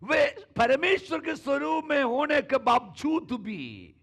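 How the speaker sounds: high, loud, slow preaching; full-force preaching. Real, not acted. Pastor preaching healing to the congregation, loud and high. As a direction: proclaim it to the whole church.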